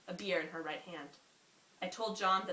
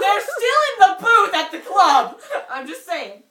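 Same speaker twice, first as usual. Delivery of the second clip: laughing; 12 semitones higher, 18 dB louder, slower than usual. Real, not acted. Reader defends scene while laughing.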